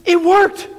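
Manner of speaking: high, loud cry of astonishment; amazed, excited woman. Acted. Sick woman to minister after the prayer, high excited amazement. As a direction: exclaim it up high in joyful disbelief.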